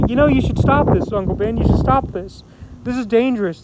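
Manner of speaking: loud urgent pleading with the injured uncle; worried nephew pushing his uncle hard; urgent plea. Retold or imitated. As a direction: raise the voice, begging him to stop.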